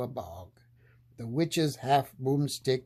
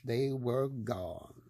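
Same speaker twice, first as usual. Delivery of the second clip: ordinary delivery, nothing marked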